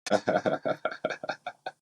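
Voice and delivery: soft, voiceless wheezing chuckle; hushed run of breathy laughter; odd laugh. Real, not acted. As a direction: let out a long, quiet, airy laugh. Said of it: "What was that laugh?".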